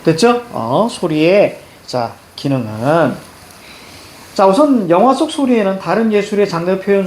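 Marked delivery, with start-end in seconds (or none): 0.9-3.3 very slow, 소리의 and 기능은 each drawn out; slow model reading, long final syllable on 소리의 and on 기능은; chunked reading demo. Shown as a demonstration. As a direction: stretch the endings of 소리의 and 기능은. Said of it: "이렇게 읽어줘야 돼".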